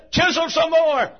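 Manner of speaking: shouted plea. Acted. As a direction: shout it out, high and slow. Jacob begging God to keep going.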